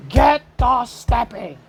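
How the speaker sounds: slow, loud put-on Martin Lawrence catchphrase; a light Martin Lawrence bark; Martin Lawrence impression. For the viewers, a light Martin Lawrence impression. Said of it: "In my Martin Lawrence voice".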